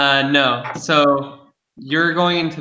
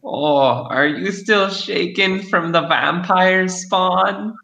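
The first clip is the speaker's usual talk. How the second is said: high, slow crone voice; fortune teller; 6 semitones higher, slower than usual. Acted. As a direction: tease him like an old hag.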